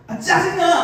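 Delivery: high-pitched mock irritation; annoyed outburst. Shown as a demonstration. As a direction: show them how to snap in annoyance. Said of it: "이렇게 한번 해보세요".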